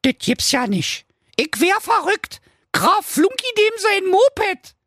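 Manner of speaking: very high, squealing princess; excited princess. Acted. Princess shows her dad Flunky's Instagram, squealing. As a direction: gush with a giggle. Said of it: "die Königstochter rief".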